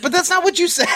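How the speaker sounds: schoolboy arguing with his teacher in a high whine; kid protesting. Retold or imitated. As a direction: squeak it out like an indignant child. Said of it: "I'm like".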